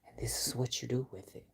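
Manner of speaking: soft threatening whisper. Real, not acted. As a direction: breathe it out coldly. Quiet threat to a rival.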